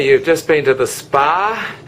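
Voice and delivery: usual speaking voice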